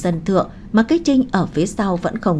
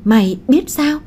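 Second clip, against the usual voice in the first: mildly startled question, pitched higher; slight astonishment; 6 semitones higher, 3 dB louder than usual. A narrator's reading. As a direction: ask it with a touch of surprise. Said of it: "nét mặt cũng có chút kinh ngạc".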